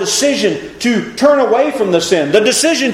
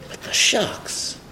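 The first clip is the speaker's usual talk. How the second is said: whispered; 10 dB quieter, slower than usual. Acted. Stressing the corn shucks.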